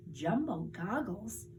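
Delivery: ordinary delivery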